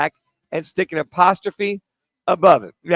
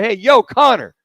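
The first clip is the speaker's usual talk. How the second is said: exclamation call; 5 semitones higher, 8 dB louder than usual. Shown as a demonstration. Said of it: "and I'm exclamation".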